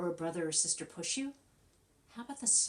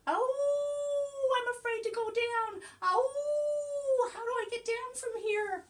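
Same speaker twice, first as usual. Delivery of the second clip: very high, scared little puppy voice; puppy afraid at the top of the slide, very high and anxious; nervous puppy; 6 dB louder, slower than usual. Pretend. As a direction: whimper it out like a pup stuck on top. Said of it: "I think he's saying".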